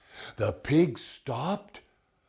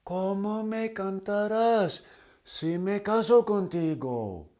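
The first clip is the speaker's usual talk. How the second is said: much higher, louder, quick questioning; butterfly voice; 10 semitones higher, 5 dB louder than usual. Acted. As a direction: squeak it out as a dainty butterfly.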